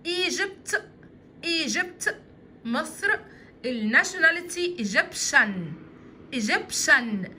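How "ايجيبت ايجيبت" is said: ايجيبت said clearly with a rising tune; teacher drilling ايجيبت for children; model word. Shown as a demonstration. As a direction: pronounce ايجيبت as "ee-jebt", rising at the end. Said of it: "وكانتري بلد".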